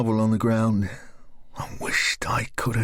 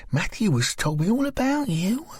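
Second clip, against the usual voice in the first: put-on higher, friendly young woman's voice; higher, coaxing woman's voice; Lisa's voice; 10 semitones higher than usual. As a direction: coax a strange girl gently. Narrator voices Lisa.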